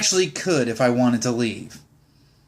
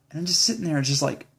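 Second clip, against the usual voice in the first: ordinary talk, nothing marked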